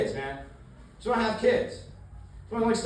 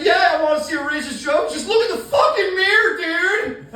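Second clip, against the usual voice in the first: mocking teen shout; 9 semitones higher, 7 dB louder than usual. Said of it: "He's like".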